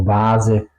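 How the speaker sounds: "vase" with a voiced v; V como W alemana. Shown as a demonstration. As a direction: say "vase" as "vaa-ze", slowly. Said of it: "se pronuncia como una". ("vase" con V sonora alemana)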